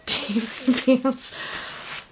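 words broken up by real laughter; giddy, laughing as she talks; laughing with delight. Real, not acted. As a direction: slowly laugh through the words. Overjoyed opening gifts, laughing.

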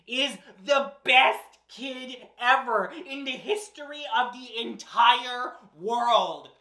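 ordinary delivery

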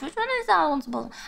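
normal talking voice

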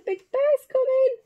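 high squeal of storybook dread; mock alarm. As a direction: climb high in pretend fright for the children. Acts Eddie's fear as bear approaches.

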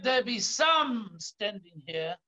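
slow, deliberate scripture quote; emphasized quote. Shown as a demonstration. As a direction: lean on each word of the promise. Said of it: "I want to emphasize that".

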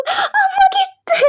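high, slow crying; hammed-up weeping; pretend sobbing. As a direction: sob it out. Crying over loss.

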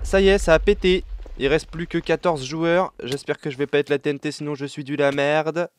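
usual speaking voice

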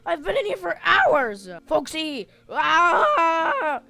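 loud, high-pitched frantic pleading; hammed-up trapped panic; panicked shouting. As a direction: beg at full volume to be let out. Trapped among endless clarinets, begging out.

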